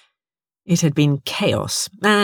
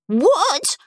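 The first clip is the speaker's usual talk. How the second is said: put-on high-pitched childlike wail; 12 semitones higher than usual. A narrator's reading. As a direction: wail it in high-voiced dismay. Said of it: "wailed Stront".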